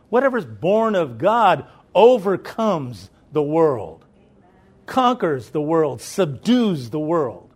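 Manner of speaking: slow, loud, weighty preaching of each phrase; slow preaching emphasis. Acted. Pastor declaring scripture to the church, slow and loud. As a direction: lean on every phrase like a pulpit declaration.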